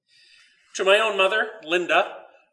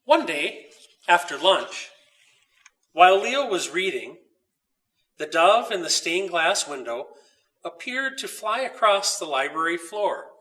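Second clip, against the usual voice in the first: calm storybook reading, unhurried; story narration. A narrator's reading. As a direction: read it like a bedtime story to listeners. Reads the story aloud.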